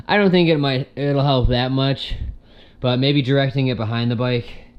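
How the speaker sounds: panting after tightening bolts; out of breath. Real, not acted. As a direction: talk while catching your breath. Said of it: "why am I out of breath from tightening that".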